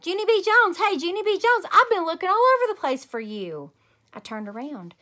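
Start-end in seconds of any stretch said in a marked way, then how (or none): 0.0-3.7 high, loud calling out across a playground; hollering. Acted. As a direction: holler her name from far away. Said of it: "I heard a voice holler".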